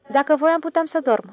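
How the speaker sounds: quick defensive retort. A narrator's reading. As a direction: defend yourself, a bit stung. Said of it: "se apără domnul Fotiade".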